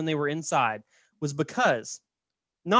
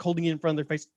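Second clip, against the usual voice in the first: ordinary talk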